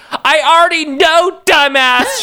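put-on high, slow exasperated girlfriend voice; hammed-up annoyed girlfriend; mock yell. A narrator's reading. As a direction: snap back at a clueless boyfriend. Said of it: "she yelled".

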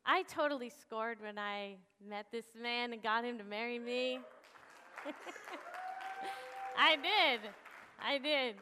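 usual speaking voice

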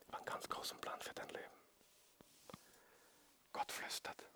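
hushed, voiceless words of affection; whispered as if right into a friend's ear; intimate whisper. Shown as a demonstration. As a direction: whisper tenderly, close to one listener. Whispers to volunteer: God whispers.

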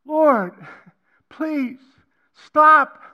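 builds from a plea to a loud, high, anguished cry; retold anguished prayer climbing to a loud high cry; desperate cry to God. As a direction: beg God to make it stop, rising to a shout. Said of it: "I cried out".

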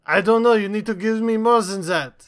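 put-on French accent, high and loud; French character. The French guest answering art questions in a mock French accent. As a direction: keep the mock French voice going.

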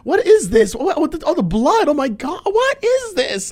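very high, loud, panicked mom voice; impression of mom. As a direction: shriek it like a startled mother. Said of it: "the worst impression of my mom ever".

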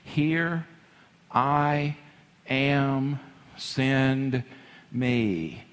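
one word at a time, spaced out; deliberate, with a pause between words; solemn reply. Acted. Isaiah answers the Lord's call, solemn and word by word. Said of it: "And then I said".

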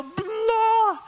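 hammed-up explosion of temper; mock outburst. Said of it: "you don't have to go".

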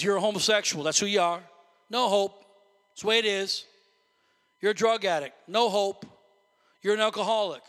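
loud, hard accusing taunts; devil's accusing voice. Acted. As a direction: spit out every label like a verdict. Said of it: "He says".

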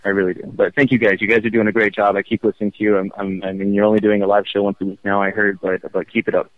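normal talking voice

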